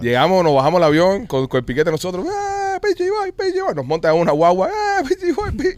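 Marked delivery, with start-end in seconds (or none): 2.2-3.8 high, excited call of a nickname across the crowd. Retold or imitated. Mimics crowd greeting on arrival. 4.7-5.8 high, excited call of a nickname across the crowd. Retold or imitated. Mimics greeting at the bus.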